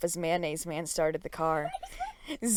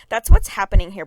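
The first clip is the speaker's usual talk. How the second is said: louder, blunt statement; loud explanation; 13 dB louder, faster than usual. A narrator's reading. Harry explaining to the girl, loud blunt statement. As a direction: state it flat out.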